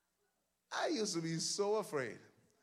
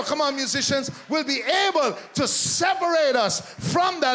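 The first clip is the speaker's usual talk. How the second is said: higher, louder shouted preaching over music; preaching at full force; 9 semitones higher, 11 dB louder, slower than usual. Real, not acted. Preacher calls the church to praise, shouting over the musicians. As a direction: drive it home, shout it.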